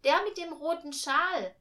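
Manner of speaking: put-on high, loud child's protest; insistent little girl. A narrator's reading. Millie insists the angel is there.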